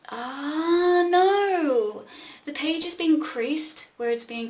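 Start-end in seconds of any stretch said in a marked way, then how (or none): 0.1-2.1 startled no; sudden high alarm; surprised. Real, not acted. Reader spots a misprint.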